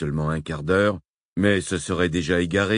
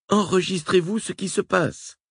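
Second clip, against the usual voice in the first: Francesca pressante; 13 semitones higher than usual. A narrator's reading. Francesca asks eagerly.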